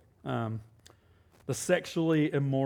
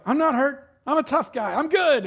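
higher, louder brave front; insisting he's fine after the stick hit; tough guy act; 8 semitones higher, 5 dB louder than usual. Retold or imitated. Preacher to son, playing tough guy. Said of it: "And I was like".

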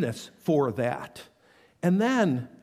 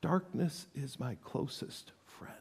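hushed, breathless whisper; whispered despair; whispered lament; 3 semitones lower, 13 dB quieter than usual. Acted. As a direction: whisper it like a confession. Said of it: "Cry out".

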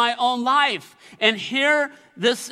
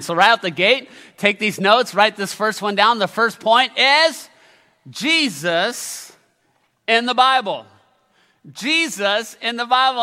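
louder, punched sermon point; preaching emphasis; 2 semitones higher, 6 dB louder than usual. Acted. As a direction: announce the point with force. Announcing first sermon point.

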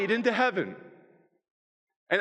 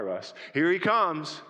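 ordinary talk, nothing marked

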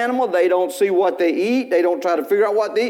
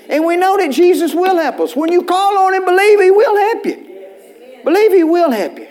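preaching climax; 10 semitones higher, 4 dB louder than usual. Acted.

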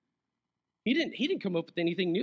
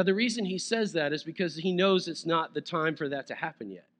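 ordinary delivery

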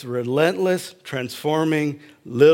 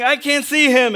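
high, louder, anguished wail; anguished cry; 11 semitones higher, 5 dB louder than usual. Retold or imitated. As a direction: wail it in desperation. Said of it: "She's crying".

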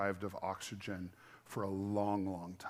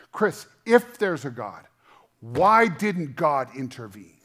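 loud, pleading retold question to God; anguished outcry; 2 semitones higher, 12 dB louder than usual. Grieving friend demands why God didn't intervene, loud. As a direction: voice the friend's raw protest, raised.

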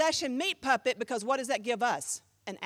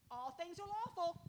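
mock slogan; 4 semitones higher, 14 dB quieter than usual. Quoting Corinthians' slogan mockingly.

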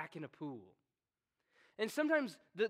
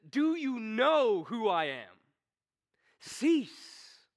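louder, slow, stern royal rebuke; mocked king's rebuke; 4 semitones higher, 7 dB louder, much slower than usual. A king mocked by his subjects, sternly rebuking them. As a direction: demand respect like a scorned king. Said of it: "the voice of a king who's being mocked".

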